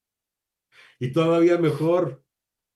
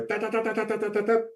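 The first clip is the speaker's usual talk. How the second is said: rattled off much higher, machine-gun quick; imitating rapid-fire spelling; 9 semitones higher, faster than usual. Mimicking kids spelling at a contest, rapid-fire high ta-ta-ta. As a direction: fire off the syllables like shots. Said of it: "pero de disparo".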